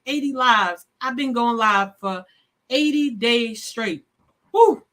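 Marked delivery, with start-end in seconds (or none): 4.5-4.8 big high whoop of joy; whooping in celebration; excited whoop. Real, not acted. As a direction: cheer it out loud and high. Celebrating eighty days live.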